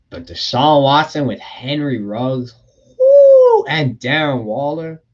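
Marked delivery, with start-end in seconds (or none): 3.0-3.6 high loud whoo; excited whoo. Real, not acted. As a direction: hype yell. Hyped about Raiders offense.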